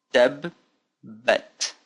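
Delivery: DAB, pause, then BAT; slow breakdown. Shown as a demonstration. Teacher repeating the word for learners, slow split syllables. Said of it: "Let's do it slowly now".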